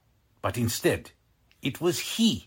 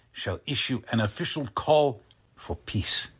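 normal talking voice

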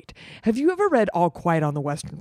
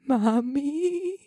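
shaky voice; 9 semitones higher than usual. Acted. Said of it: "He said in a shaky voice".

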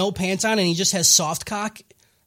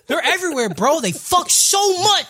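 high-pitched, worked-up ranting; exasperated mock rant; 8 semitones higher than usual. Goblin Slayer warning about goblins, exasperated rant.

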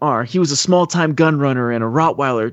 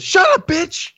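high-pitched acted outburst; mock angry snap; slower than usual. Samson silencing his sister, mock angry outburst. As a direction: cut the caller off in mock anger.

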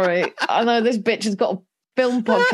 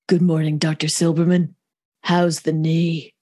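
a hint of a guarded Sarah Connor; Sarah Connor quote; 3 semitones lower than usual. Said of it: "Sarah Connor from Terminator two".

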